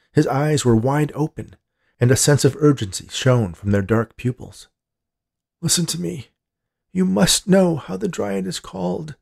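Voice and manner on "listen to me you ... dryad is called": put-on urgent high-pitched old man voice; insistent grandpa. A narrator's reading. Grandpa grips his granddaughter's wrist, urgently insisting. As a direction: press her to listen, pitched well up. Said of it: "he insisted".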